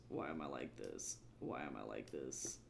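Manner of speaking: very low, hushed self-reproach; despairing mutter to self. Real, not acted. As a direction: mutter it very low and very soft, to yourself. Streamer despairing to self over hard drawing.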